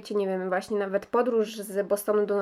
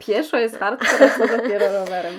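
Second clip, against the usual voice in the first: said through real laughter; laughing; 7 dB louder than usual. Real, not acted. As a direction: laugh loudly while joking. Joking about cycling between states. (mówione przez śmiech)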